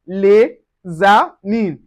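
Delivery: slow "les amis", S linked as a Z; linked pronunciation. Shown as a demonstration. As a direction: say "les amis" as lay-za-meen. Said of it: "together it is pronounced".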